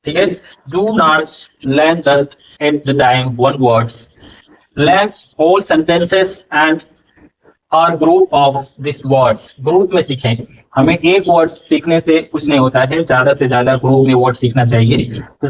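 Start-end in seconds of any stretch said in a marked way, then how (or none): 0.7-9.4 each word spaced out slowly for writing down; slow, deliberate classroom dictation. Shown as a demonstration. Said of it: "teacher dictates the rule".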